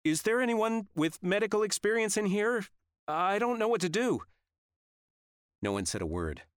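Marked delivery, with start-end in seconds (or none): 0.0-4.2 high, loud panicked plea; frantic, high-pitched appeal to a crowd; nervous. A narrator's reading. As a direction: call out for help, pitched way up and rattled. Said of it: "Nervous like I'd never heard her".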